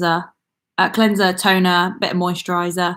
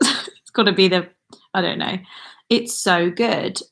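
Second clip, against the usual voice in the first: usual speaking voice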